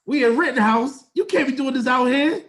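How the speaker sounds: loudly remonstrate, as if reliving it; retold scolding. Retells scolding her in public.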